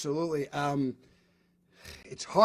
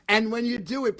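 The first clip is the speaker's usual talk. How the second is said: ordinary delivery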